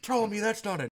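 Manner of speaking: high, loud, fast blurted question; mock outraged blurt. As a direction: rush it out, shocked and tactless. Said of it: "He's just like".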